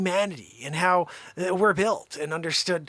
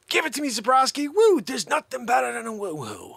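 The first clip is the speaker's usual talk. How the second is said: hyped Zabrowski bit; 6 semitones higher than usual. Retold or imitated. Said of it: "We used to always just be like".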